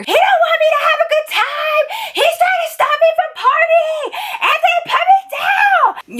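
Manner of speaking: put-on very high, shrieking drunk protest with laughter; screaming drunk. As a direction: scream while being carried out. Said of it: "screaming bloody murder".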